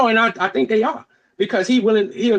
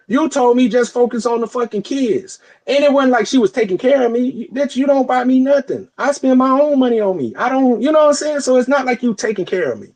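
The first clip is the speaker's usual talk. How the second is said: higher-pitched, aggrieved protest; indignant retort; 6 semitones higher than usual. Retold or imitated. Dad answering his ex's claim of supporting him, indignant. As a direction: argue back heatedly in a raised pitch.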